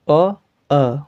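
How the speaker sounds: अ said as a rounded o twice; अ voiced like o, a teacher's model; Bengali o sound. Shown as a demonstration. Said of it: "यहाँ पे भी same".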